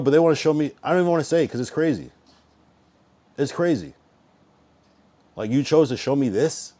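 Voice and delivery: usual speaking voice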